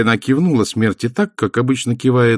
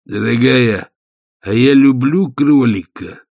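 a hint of a big gruff husband, slow; War's voice; 3 semitones higher, 4 dB louder, slower than usual. A narrator's reading. Husband meekly asking his wife, lightly voiced warrior. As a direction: plead gently as a henpecked warrior.

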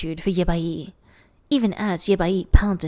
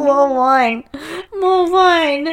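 high, loud call for more; tipsy demand; 7 semitones higher, 6 dB louder, much slower than usual. Real, not acted. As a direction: call out tipsily for a refill.

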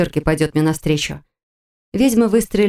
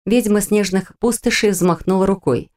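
usual speaking voice

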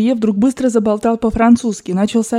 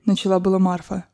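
plain reading voice

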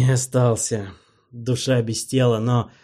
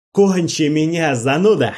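pleading yell; 6 semitones higher, 6 dB louder than usual. Acted. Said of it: "шептал или орал".

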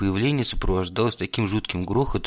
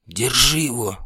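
urgent hissed command, higher; hissing feral vampire; 4 semitones higher, 4 dB louder, slower than usual. Acted. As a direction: hiss it out like a vampire. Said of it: "зашипел вампир". (шипящий вампир)

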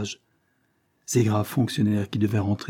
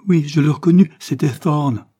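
raised, much louder outburst; exclaiming; 6 semitones higher, 7 dB louder than usual. A narrator's reading. Richard bursts out to his sister, loud and shaken. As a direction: shout the recognition out. Brother's climax of recognition.

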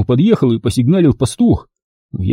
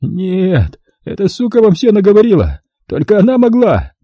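helpless fury howl; 6 semitones higher than usual. Acted. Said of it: "В бессильной злобе взвыл". (взвыл в бессильной злобе)

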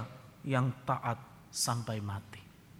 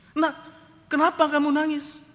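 surprised father; 13 semitones higher, 8 dB louder than usual. Retold or imitated. Father surprised by crying son.

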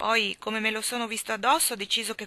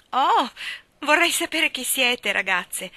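higher and louder, a bright eager cry of greeting; warm exclamation; 5 semitones higher, 6 dB louder, slower than usual. A narrator's reading. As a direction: greet the girls with sudden eager delight, slower. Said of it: "esclamò calorosamente".

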